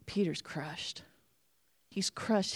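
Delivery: quiet sorrow; hushed, grieving. Real, not acted. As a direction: let the grief sink in. Peter's grief.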